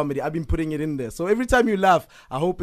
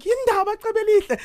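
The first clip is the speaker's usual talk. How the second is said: high, alarmed mother's voice; worried mother. Retold or imitated. Mother fearing her son is in trouble in LA, high alarmed mom voice. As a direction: squeak it like a panicked mom. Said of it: "She's like".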